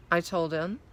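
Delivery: ordinary delivery, nothing marked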